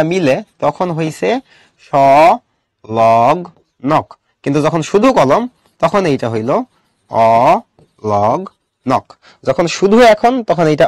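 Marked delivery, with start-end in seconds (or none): 1.9-4.1 সংলগ্নক in long, separated syllables. Shown as a demonstration. Teacher breaks up the term. 7.1-9.1 অলগ্নক in long, separated syllables, lower. Shown as a demonstration. Teacher breaks up contrasting term.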